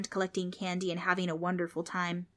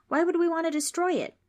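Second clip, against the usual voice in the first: put-on pleading kid; 11 semitones higher, 5 dB louder than usual. Frank pleads.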